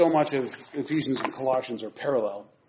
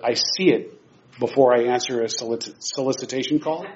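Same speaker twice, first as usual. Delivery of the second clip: normal talking voice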